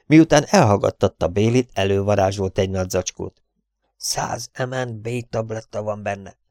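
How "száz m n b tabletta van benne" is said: slow, hushed aside; softly, conspiratorially; furtive undertone. Acted. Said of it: "majd rekett hangon suttogta".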